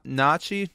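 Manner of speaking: "nachi" with no G, as "NOTCH-ee"; silent G attempt. Real, not acted. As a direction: tentatively try "nachi" with silent G and a ch sound. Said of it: "is this a silent G".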